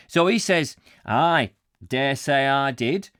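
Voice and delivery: slow, deliberate Yorkshire reply; Yorkshire accent. Acted. Warehouseman retells the boss's admission, deliberate Yorkshire accent. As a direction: answer unhurriedly in put-on Yorkshire. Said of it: "this aggressive Yorkshire voice".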